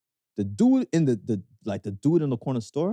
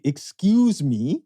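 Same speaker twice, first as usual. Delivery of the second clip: put-on slow, louder Canadian accent; 5 dB louder, much slower than usual. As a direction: draw out a polite Canadian excuse me. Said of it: "the Canadian accent".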